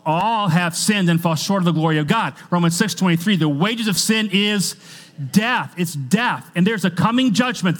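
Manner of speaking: loud, high, urgent sermon delivery; preaching at full force. Acted. Preacher warning the church of coming judgment, loud and high. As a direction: drive each line home to the back pew.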